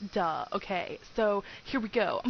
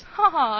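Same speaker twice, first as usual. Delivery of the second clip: loud, high "ha ha" at her own joke; hammed-up loud ha ha; mock laugh; 7 semitones higher, 14 dB louder than usual. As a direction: bark out a fake triumphant laugh. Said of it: "I pulled another funny".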